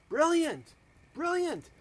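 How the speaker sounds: high-pitched delighted praise; delighted approval. Acted. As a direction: exclaim it like a pleased king, pitch up high. King delighted with Haman's advice.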